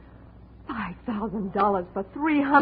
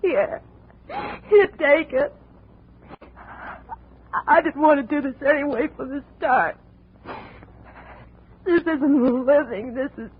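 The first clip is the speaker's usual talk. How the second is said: a broken, weary surrender; breaking down; 4 semitones higher than usual. Acted. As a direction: voice cracks high then slows. Andrea breaks down, hands over gun.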